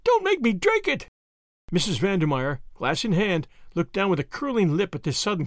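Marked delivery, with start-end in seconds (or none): 0.0-1.1 very high-pitched shrieked plea; terrified shriek. A narrator's reading. Captive begging at her captor's knees, shrieking. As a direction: let the voice rise to a shriek. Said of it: "Her voice rose to a shriek".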